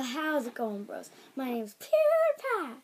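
mock YouTuber intro, name drawn out; PewDiePie. Kid copying a YouTuber's intro, hammed-up impression. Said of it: "I sound like PewDiePie".

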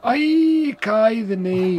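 high, loud hammed-up Scottish accent; mock Scottish brogue; terrible Scottish accent. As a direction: overdo the Scottish accent to wind people up. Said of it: "terrible accents on purpose".

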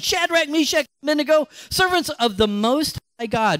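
high called-out names toward the furnace; shouting the names. Acted. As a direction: call them out from the furnace door. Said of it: "and shouted".

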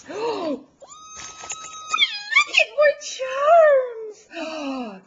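high, long-drawn delighted squeal; thrilled squeal. Real, not acted. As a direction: squeal slowly with mounting delight. Squealing at more gifts.

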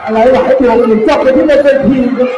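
live play-by-play, pushing it; excited boxing commentary. Real, not acted. Live Muay Thai fight commentary.

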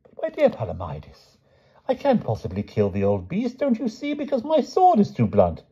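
put-on high, dithering old knight fretting in a tree; fussy old knight. A narrator's reading. Pellinore to Grummore, dithering high-pitched knight. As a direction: fret and dither like a flustered knight.

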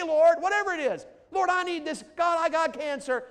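loud, high crying out to God; pleading prayer. Shown as a demonstration. Pastor voices a sample prayer.

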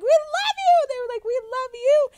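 high, loud squealed declarations; retold screaming. Fans and group screaming at each other, high mock yells. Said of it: "all screaming at each other".

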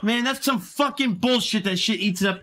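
high, heated cursing; angry rant. Real, not acted. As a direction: vent your fury at the game. After losing everything.

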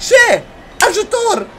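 very high cry for help; alarmed cry. Real, not acted. Scared player calls for help, high alarmed cry. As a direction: call out in fright, voice shooting up.

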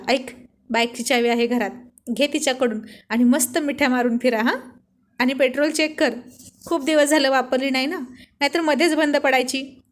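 plain reading voice

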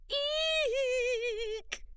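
very high squeaky eek; a huge lion squeaking like a mouse; lion's eek. Acted. Frightened lion letting out a tiny eek.

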